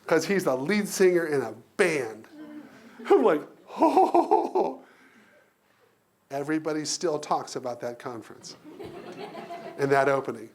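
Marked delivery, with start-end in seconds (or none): 3.8-4.8 very high, wavering imitated "oh"; awed whoop. Hearing his colleague sing the song, high thrilled whoop. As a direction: swoon loudly in amazement.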